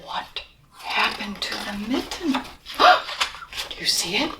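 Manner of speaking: put-on soft hushed question to the kids; whispered question. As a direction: whisper it like a secret. Whispered aside to child listeners.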